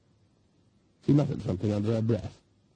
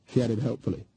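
ordinary delivery, nothing marked

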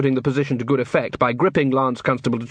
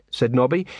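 usual speaking voice

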